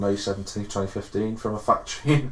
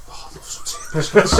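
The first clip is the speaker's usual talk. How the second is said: put-on voice, laughing through it; mock customer; 5 semitones higher than usual. Friend imagines a customer ordering tea, mock prim voice. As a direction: play a prim tea lover, giggling.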